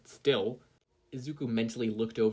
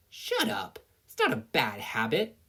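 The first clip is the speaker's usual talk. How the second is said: high, sharp protest, louder on the second line; indignant shout; 8 semitones higher, 4 dB louder than usual. Acted. Katsuki snapping at his nagging conscience, indignant. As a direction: snap back, offended.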